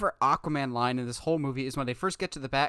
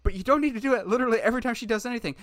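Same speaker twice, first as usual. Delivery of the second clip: exasperated; 6 semitones higher, faster than usual. Retold or imitated. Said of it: "I'm like".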